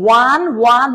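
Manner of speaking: ওয়ান said slowly with a clear w glide at the start; sounding out one. Shown as a demonstration. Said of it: "ওয়ানের উচ্চ- উচ্চারণে ডব্লিউ চলে আসে".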